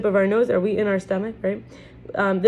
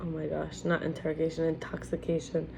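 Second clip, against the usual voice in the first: much softer, correcting herself under her breath; quiet aside to self; 3 semitones lower, 11 dB quieter than usual. Real, not acted. Teacher realising she misread the book, much softer aside. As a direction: drop right down as the mistake dawns on you. Catching own misreading aloud.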